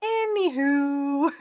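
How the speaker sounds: slow, high, sing-song "Anywho"; sassy anywho. Shown as a demonstration. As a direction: sing it out breezily, dismissing the fear. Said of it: "some clients go".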